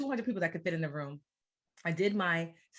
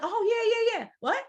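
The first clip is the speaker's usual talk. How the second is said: high, louder "oh yeah yeah", then a puzzled "what?"; pitched-up, bewildered agreement; confused surprise; 5 dB louder than usual. Retold or imitated. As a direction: nod along, then catch yourself. Confused by "overflow.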